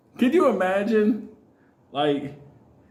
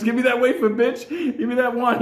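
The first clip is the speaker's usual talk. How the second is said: desperate demand; 6 semitones higher than usual. Pretend. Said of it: "I'd have been in fucking the church".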